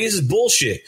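high-pitched retold gripe; pissed off. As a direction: act out being fed up. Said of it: "I'm already pissed off now".

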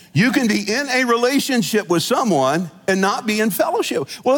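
ordinary talk

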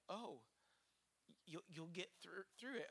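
soft, hesitant mock reassurance; awkward comforting. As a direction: act out not knowing what to say, very softly. Acts awkward reply to someone upset.

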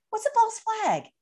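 put-on high, soft confused old lady; puzzled grandma. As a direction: play a baffled grandma asking. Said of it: "Grandma's gonna be like".

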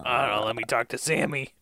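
a dummy craving a smoke; grumpy dummy voice. Pretend. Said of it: "He'll be like".

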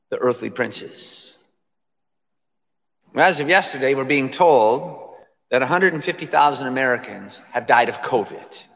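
ordinary talk

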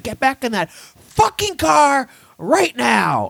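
high, slow, each word barked as a furious order; angry cop order. Retold or imitated. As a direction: spit it out slowly as a furious command. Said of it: "But he was like".

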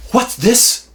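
high, loud prankster's accusation; mock accusing. Uncle confronting nephew over the floor, loud mock accusation. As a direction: pounce on it like found evidence. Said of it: "and he's like".

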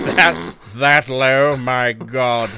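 scandalized snob, slow and drawn out; hammed-up upper-crust disbelief; bourgeois impression. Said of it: "Bourgeois Impression".

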